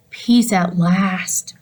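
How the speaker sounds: slow, drawn-out relief; relieved sigh. A narrator's reading. Hamster sighing in relief once the TV is off, slow. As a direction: let the words sink in slowly.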